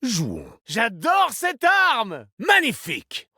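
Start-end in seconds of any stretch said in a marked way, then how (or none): 0.7-2.3 high, delighted cry; exhilarated. Acted. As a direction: relish the weapon, pitched way up and drawn out. Gleeful battle voice line about weapon. (exalté, voix haut perchée)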